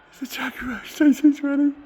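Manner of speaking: excited reveal. Real, not acted. Presenting the car to his viewers, ecstatic high shout. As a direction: announce it like a showman unveiling a prize.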